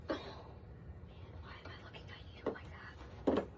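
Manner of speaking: whisper. Real, not acted. Aside to the camera.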